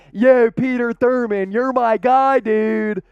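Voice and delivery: put-on Delco accent for a shout-out. Host thanks a viewer, hammed-up Delco accent. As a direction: hail him like a Delco buddy. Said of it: "Delco all the way from Peter Thurman".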